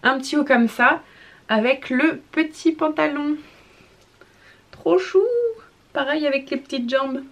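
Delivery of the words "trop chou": very high gushing coo; cutesy squeal. Real, not acted. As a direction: squeal at how cute it is. Gushing over cute baby clothes.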